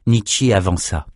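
ordinary delivery

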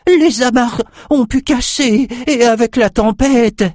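very high, slow pleading; desperate excuse. A narrator's reading. Bleeding cook pleading with the king, desperate. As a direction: scramble for an excuse.

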